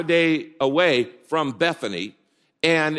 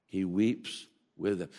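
much softer, slower "He weeps with them"; hushed and tender, as if moved yourself; soft, tender repeat; 7 semitones lower, 7 dB quieter than usual. Shown as a demonstration. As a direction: let the line sink in quietly.

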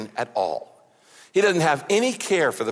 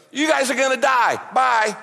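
flippant, offhand brush-off, higher and louder; careless dismissal; 8 semitones higher, 3 dB louder than usual. Retold or imitated. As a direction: toss it off like you couldn't care less. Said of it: "He doesn't care two bits".

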